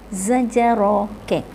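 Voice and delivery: usual speaking voice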